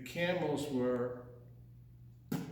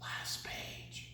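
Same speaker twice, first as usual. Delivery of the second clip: whisper; 12 dB quieter than usual. Real, not acted. Whispered aside.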